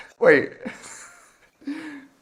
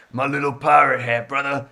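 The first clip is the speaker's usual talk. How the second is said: lightly voiced wrestler catchphrase; a hint of a showman wrestler's voice; Hulk Hogan. Podcaster plays the wrestler about his hat, lightly voiced impression. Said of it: "Hulk Hogan".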